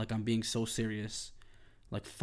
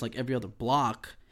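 ordinary talk

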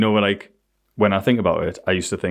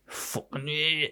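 mock teen sneer; 5 semitones higher, 11 dB quieter, much slower than usual. Mocking kids' sneer.

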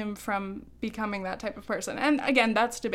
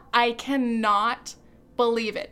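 ordinary talk, nothing marked

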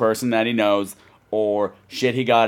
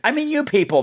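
mock indignant; 14 semitones higher than usual. Answering back playfully.

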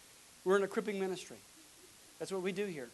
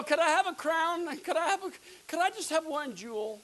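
higher-pitched mock begging; pleading; 9 semitones higher, 5 dB louder than usual. Believer in line for rewards, mock pleading. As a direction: ask high, like begging for a prize.

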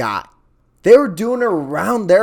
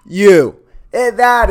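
sudden angry shout; 5 semitones higher, 7 dB louder, slower than usual. Real, not acted. Ranting at the coach, a loud angry shout. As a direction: bark the word out loud, accusing.

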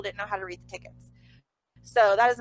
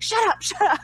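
laughing hush; 9 semitones higher, 6 dB louder than usual. Retold or imitated. Husband hushing her again.

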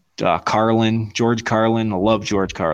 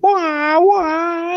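mock baby wail. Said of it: "he's like".

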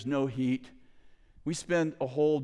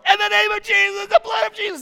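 high, loud, fervent cry; intense shouted prayer; 10 dB louder than usual. Retold or imitated. Team praying against the noise, intense shouting. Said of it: "more intense, and it gets more intense".

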